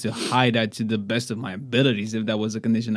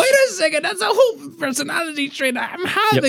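high, exaggerated comic voice; cartoonish high-pitched bewildered quote; mock reaction. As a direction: squeak it out, pitched way up in disbelief. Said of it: "I'm like".

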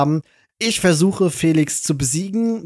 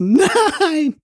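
drawn-out high wail of no; dramatic despairing no; 13 semitones higher, 3 dB louder than usual. Retold or imitated. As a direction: wail it slow and high in mock horror. Retelling his own horrified reaction.

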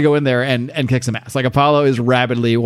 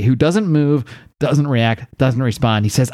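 usual speaking voice

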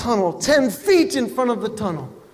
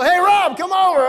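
high, mock-thrilled invitation; excited call to friend; 9 semitones higher, 3 dB louder than usual. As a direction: call a buddy over with hammed-up excitement. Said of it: "you don't call your friends about".

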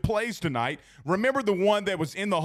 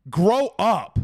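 loud, higher, fed-up scolding; exasperated grow up; 3 semitones higher, 6 dB louder, much slower than usual. Real, not acted. Host scolding his own fans, loud and fed up. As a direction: snap it at the fans like a tired parent.

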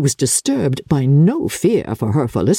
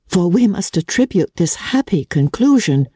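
lightly voiced calm elder daughter, a bit higher; a hint of a sensible daughter calming her mother; Jane, gentle; 6 semitones higher than usual. A narrator's reading. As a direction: soothe her mother reasonably.

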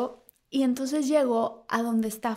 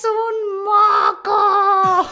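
everyone shouts it, loud and slow; drawn-out loud high shout; shouted reveal; 10 semitones higher, 9 dB louder, much slower than usual. Retold or imitated. Cast in unison, loud slow shout. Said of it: "Pero me dicen".

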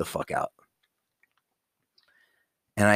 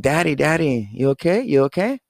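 put-on high, light child's voice, asking twice; little daughter; 6 semitones higher, 3 dB louder than usual. Father imitating his young daughter checking on him, high and light. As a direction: gently imitate a worried toddler. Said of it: "and you're like".

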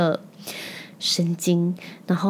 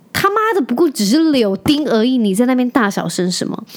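mother furious about her son, swearing; angry outburst; 4 semitones higher than usual. Real, not acted. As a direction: vent at the rude cashier. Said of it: "其实我很不爽".

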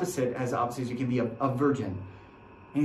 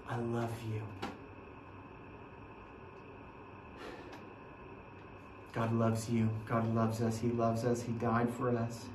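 soft, hushed guided imagining; quiet guided meditation; 3 semitones lower, 6 dB quieter than usual. Acted. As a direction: draw them quietly into the scene. Guides an imagined scene at the cross.

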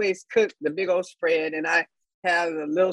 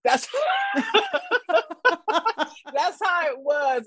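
laughing; 11 semitones higher than usual. Real, not acted. Laughing aside.

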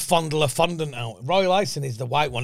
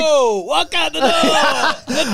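loud, laughing mock command; laughing shout; 11 semitones higher, 6 dB louder, much slower than usual. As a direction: bellow it through laughter. Mock stage directions shouted.

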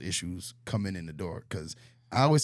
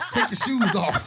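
loud, high laughing order; 11 semitones higher, 7 dB louder than usual. Pretend. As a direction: giggle out the warning. Joke about sneaking past kids.

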